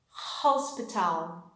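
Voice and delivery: Hospital said slowly with a breathy throaty h; slow demo word. Shown as a demonstration. Teacher modelling hospital for students to copy, slowly. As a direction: stretch Hospital and feel the h in the throat. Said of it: "you are going to say hospital".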